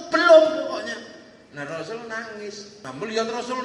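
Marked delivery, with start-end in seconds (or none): 0.1-1.0 loud burst on the first word; insistent retort. Retold or imitated. Pilgrim insisting he has never met him, loud retort. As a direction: cut in with a loud denial.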